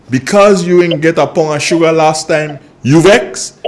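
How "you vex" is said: ordinary talk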